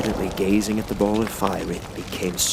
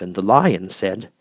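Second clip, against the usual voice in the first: ordinary delivery, nothing marked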